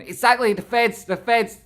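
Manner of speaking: loud, high shouting; hyped shout at chat; excited shout. Real, not acted. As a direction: yell it out gleefully.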